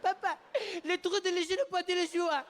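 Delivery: high, wailing mock-French gibberish; tearful French child. Child reproaching his dad in French, mock tearful wail. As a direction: bawl it as a little boy between gasps. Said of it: "They're both in tears".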